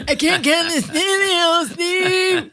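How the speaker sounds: high, slow, whining about a cramping hand; high whiny author voice; whiny mock complaint. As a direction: moan it like a worn-out author. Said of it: "I have hand cramps".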